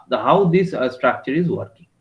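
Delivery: usual speaking voice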